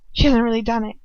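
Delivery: ordinary delivery, nothing marked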